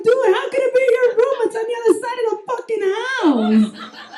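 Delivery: high, loud squealing protest; hammed-up indignant yelp; exasperated disbelief. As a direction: argue with a drunk in sheer disbelief. Arguing with confused drunk uncle.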